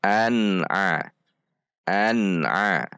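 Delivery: an-'a joined, N clear; clear izhar. Shown as a demonstration. Teacher models practice word, an-'a clear and joined. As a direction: join an to throaty a without bouncing or droning. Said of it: "dengan cara membaca".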